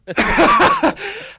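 loud high laugh; high burst of laughter. Real, not acted. Laughs at amen joke.

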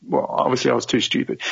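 ordinary talk

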